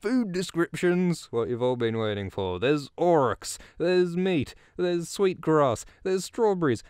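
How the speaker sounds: building giddy delight at the feast; excited gushing. Real, not acted. Gushing over book food descriptions.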